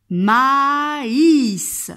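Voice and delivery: "Maïs" loud and drawn out, both vowels long; word for repeating. Shown as a demonstration. Teacher giving a word to repeat, "Maïs" slowly and loudly modelled. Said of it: "Je vais le dire deux fois".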